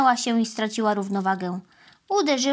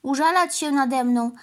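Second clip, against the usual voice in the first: a hurt cow telling her tale; lightly voiced sorry cow; plaintive cow; 6 semitones higher than usual. A narrator's reading.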